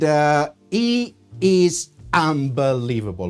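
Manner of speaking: normal talking voice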